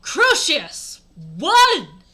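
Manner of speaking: high, very loud spell cry; shouted. Acted. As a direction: bellow it like casting a curse. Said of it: "I shouted selectively".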